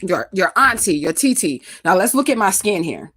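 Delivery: ordinary talk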